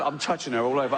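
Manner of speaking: ordinary talk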